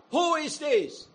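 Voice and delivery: high, eager crowd asking who this is; excited crowd voice; proud curious question. Retold or imitated. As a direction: ask it like a thrilled onlooker. Proud crowd asks about Jesus.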